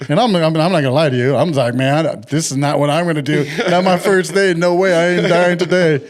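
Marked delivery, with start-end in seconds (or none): 1.7-6.0 louder, higher half-joking refusal; mock panic. New salesman refusing the steep roof, mock panicked protest. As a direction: protest like a man who won't climb up there.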